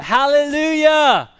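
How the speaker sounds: long, high, full-voiced shout of praise; crying out. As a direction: cry it out like a great multitude. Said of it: "Once more they cried out".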